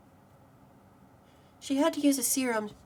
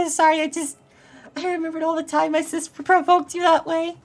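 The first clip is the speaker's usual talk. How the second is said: higher, louder, laughing apology; 5 semitones higher, 8 dB louder than usual. Acted. Amused by how the sister provoked the friend, laughing. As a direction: apologise through bubbling laughter. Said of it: "Are you laughing?".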